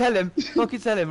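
loud, high playful complaint; heated appeal. Real, not acted. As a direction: demand that the others back you up. Squabbling over who got there first.

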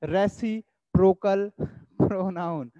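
drawn-out, careful classroom dictation; slow dictation. Shown as a demonstration. Teacher dictates the term to students, very slow. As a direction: spell the term out very slowly for notes. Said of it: "dictating term slowly".

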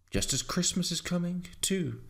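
high-pitched, pleased gossip; chatting villager. A narrator's reading.

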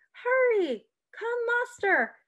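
high urgent rallying cries; cried out. A narrator's reading. Sybil calling the patriots to arms, crying out. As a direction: rouse the patriots, high and pressing. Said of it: "Sybil cried out to the patriots".

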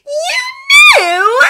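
very high, shouted disbelief; shrill demand. A narrator's reading. Hermione finding out Draco knew, outraged shriek. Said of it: "she demanded".

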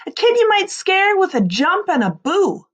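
plain reading voice

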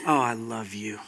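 very soft, low reassurance; tender Father. Acted. The Father answering a frustrated son, tender and calm. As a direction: answer gently, almost murmured.

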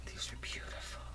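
whispering in wonder; awed whisper. Real, not acted. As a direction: breathe it reverently. Whispering over gifts.

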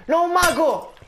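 grido acuto e forte, allarmato; 1 grido di allarme. Real, not acted. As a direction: urla vedendo il mago. Appare un mago nemico. (grido allarmato alla vista del mago)

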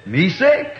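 "Me sick" laughed out while mimicking; can't keep a straight face quoting him; laughing mimic. Preacher mimics the chief through laughter.

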